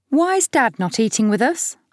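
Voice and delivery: sulky daughter. Acted. Daughter at the dinner table.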